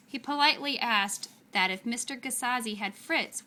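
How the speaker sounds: plain reading voice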